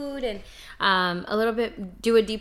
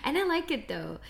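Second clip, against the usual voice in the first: ordinary delivery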